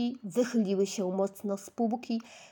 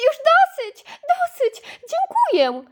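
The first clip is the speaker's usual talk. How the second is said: very high giggly protest; laughing through tickles; 8 dB louder than usual. Acted. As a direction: squeal it while being tickled. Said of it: "wołała śmiejąc się".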